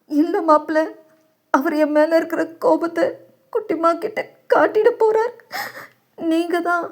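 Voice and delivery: put-on a high, soft, pleading older man; pleading father. Worried father begs his son-in-law, soft and high.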